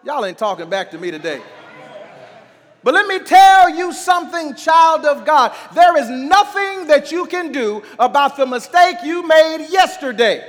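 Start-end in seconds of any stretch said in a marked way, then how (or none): 2.8-10.4 high, loud pulpit exhortation; fervent preacher urging the congregation on; full-force preaching. Real, not acted.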